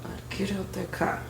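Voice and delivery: quiet, through gritted teeth. Retold or imitated. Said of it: "through gritted teeth".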